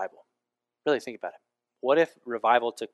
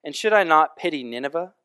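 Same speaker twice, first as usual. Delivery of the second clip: dramatic scripture reading; 4 semitones higher, 9 dB louder than usual. Acted. Preacher reads Jonah chapter four to the congregation, loud and proclaiming. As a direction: read it like a herald proclaiming the word.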